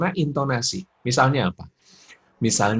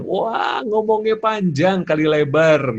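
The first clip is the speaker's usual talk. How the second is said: exaggerated chatter; 7 semitones higher, 5 dB louder, slower than usual. Acting out mothers' long chatter.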